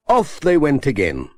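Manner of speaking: ordinary delivery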